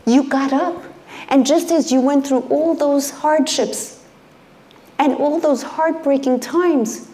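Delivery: preaching as God. Acted.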